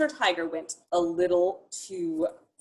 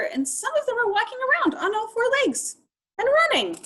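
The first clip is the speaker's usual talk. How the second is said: much higher, fast and bright-eyed; excited; 14 semitones higher, 4 dB louder, faster than usual. Acted. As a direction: rush it with giddy wonder. Excited narration of animals.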